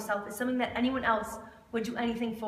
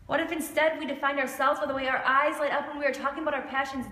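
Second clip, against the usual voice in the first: usual speaking voice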